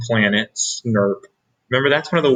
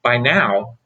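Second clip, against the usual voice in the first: ordinary delivery, nothing marked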